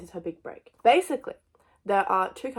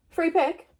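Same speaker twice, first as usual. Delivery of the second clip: surprised; 9 semitones higher, 5 dB louder than usual. Real, not acted. Rereading the prompt in disbelief.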